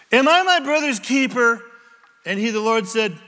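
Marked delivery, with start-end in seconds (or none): 0.1-1.6 higher and louder indignant comeback; defiant retort. Acted. Cain snaps back at God in a loud, indignant retort.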